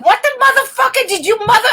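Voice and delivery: high, laughing mock rant; a hammed-up comic tirade; Pryor tirade. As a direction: blast the heckler like old Pryor. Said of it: "back into old Pryor".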